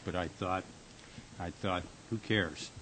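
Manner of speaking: tender and soft. Acted. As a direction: soften right down, just for her. Husband fondly teasing his wife.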